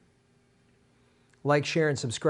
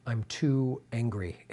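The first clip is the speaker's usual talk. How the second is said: too mad; 6 semitones lower, 6 dB quieter than usual. Real, not acted. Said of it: "I'm too mad about this".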